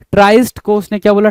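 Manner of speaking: ordinary talk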